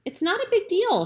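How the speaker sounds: high-pitched defensive retort. A narrator's reading. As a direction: brush it off defensively, voice jumping up. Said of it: "she answers defensively".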